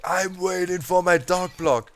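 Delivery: annoyed snap. Retold or imitated. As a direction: snap it at the visitors at the door. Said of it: "Super angry".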